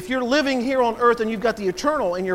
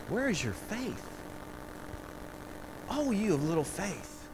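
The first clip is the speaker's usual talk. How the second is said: very soft, disappointed chiding; quiet acted reproach; hushed rebuke; 13 dB quieter than usual. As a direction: drop to a hush to chide them. Voices Jesus gently rebuking the disciples.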